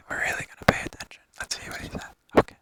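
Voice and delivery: hushed whisper, a bit fast. Pretend. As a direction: whisper it like a golf announcer. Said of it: "we're gonna be really quiet".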